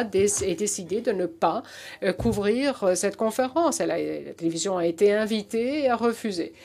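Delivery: American accent in French; French spoken with an American accent; foreign accent in french. Real, not acted. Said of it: "mon français est vraiment, euh, rudimentaire".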